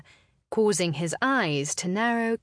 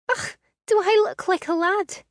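high, loud, scornful "Ugh"; indignant disbelief; exasperated; 14 semitones higher, 6 dB louder than usual. Acted. Indignant at being called lad.

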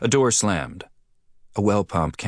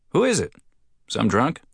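much higher, friendly call across street; a cheerful friend calling over; higher townsman voice; 6 semitones higher than usual. Acted. Sandy-haired man asks.

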